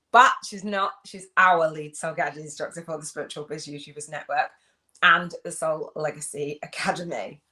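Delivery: usual speaking voice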